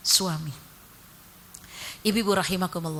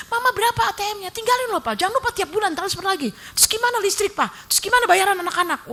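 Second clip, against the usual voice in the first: nagging wife; 10 semitones higher, 4 dB louder than usual. Pretend. Mocking a demanding wife.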